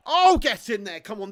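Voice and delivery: loud, high burst of triumph; goal celebration. Real, not acted. Streamer cheering the equaliser, loud and excited. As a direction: cheer the ball into the net.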